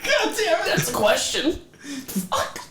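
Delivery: pained, squeaky high cursing; strained high voice. Acted. Winded character reacts in pain.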